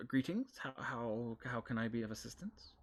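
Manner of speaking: goblin lady voice. Acted. Goblin receptionist greets adventurers in a high voice. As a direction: squeak a polite greeting up high. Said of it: "a little goblin lady".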